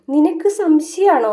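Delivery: high and slow, drawn-out probing; pointed slow question. Retold or imitated. Grandmother slowly asking Vaijayanthi if she is suspicious.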